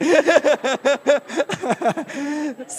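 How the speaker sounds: long, loud burst of laughter; hearty laugh. Real, not acted. Preacher cracking up after God's answer to him, laughing heartily. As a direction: laugh out loud at your own joke.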